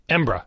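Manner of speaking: "Embra" in two syllables, "EM-bruh"; most hurried pronunciation. Shown as a demonstration. Podcaster giving the native Scots option, swallowed pronunciation. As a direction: swallow the middle of the name down to "Embra". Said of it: "if you're really in a hurry".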